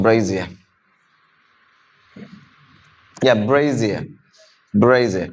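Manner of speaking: "Brazier" as low, falling BRAY-zee-uh; correct pronunciation. Shown as a demonstration. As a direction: repeat "Brazier" carefully with a buzzed z. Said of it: "It's brazier".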